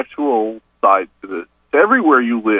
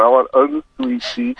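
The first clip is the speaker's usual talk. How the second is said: higher put-on Southern drawl ordering "I want unsweet tea"; imitating a Southern customer, voice up high; mock Southern accent; 12 semitones higher than usual. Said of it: "What kind of tea do you want".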